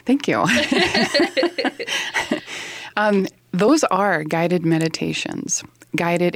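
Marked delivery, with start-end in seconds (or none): 0.5-2.9 high, airy chuckle; a real burst of light, high laughter; laughing. Real, not acted. Guest laughing after thanking the host, high chuckle.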